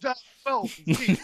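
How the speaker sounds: John Travolta. Retold or imitated. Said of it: "consist of him saying the person's name".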